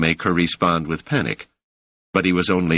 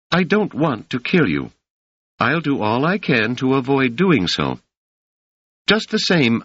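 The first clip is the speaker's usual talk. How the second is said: raised pitch, grave and resolute; high voiced solemn Doris; 8 semitones higher than usual. A narrator's reading. Doris vowing before witnesses to protect Harry, high and solemn. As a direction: swear it like an oath of honor.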